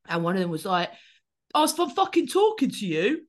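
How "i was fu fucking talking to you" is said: high, loud aggressive shout of an offended bloke; a hammed-up furious taunt shouted at a runner; mock angry yell. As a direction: bark it like a drunk who feels ignored. Mimicking an angry bloke chasing her.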